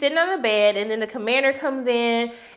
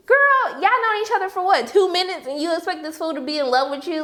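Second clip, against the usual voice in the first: incredulous scolding; 6 semitones higher than usual. Real, not acted. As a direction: scold her like a baffled friend. Mock scolding of Eden.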